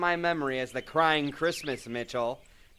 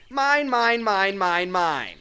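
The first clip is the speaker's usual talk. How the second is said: high, loud gloating chant of mine; gleeful gloating; 8 semitones higher, 5 dB louder, slower than usual. Acted. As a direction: crow over the prize. Greedy gloating over page.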